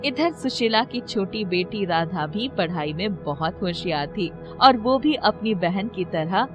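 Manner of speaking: ordinary delivery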